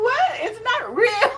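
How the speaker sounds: high, loud disbelief through laughter; laughing, tell her it's fake; squeaky laughing disbelief at mom; incredulous. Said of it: "look at her and go".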